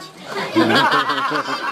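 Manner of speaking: bright real laugh. Real, not acted. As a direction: laugh at the joke. Listener laughs.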